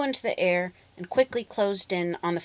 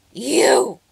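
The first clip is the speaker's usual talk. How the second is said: louder, higher holler; hollering witch; 6 semitones higher, 9 dB louder than usual. A narrator's reading. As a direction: holler it at the dog. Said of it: "she hollered".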